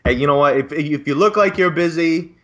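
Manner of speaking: usual speaking voice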